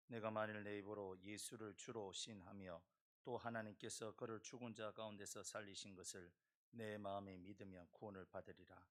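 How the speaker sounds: ordinary delivery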